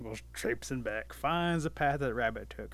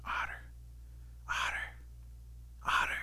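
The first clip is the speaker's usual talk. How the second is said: hushed whisper with long gaps; secretive, spaced-out whispered calls; 5 dB quieter than usual. A narrator's reading. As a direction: whisper the name to a sleeper, testing. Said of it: "Rabbit starts whispering over to Otter".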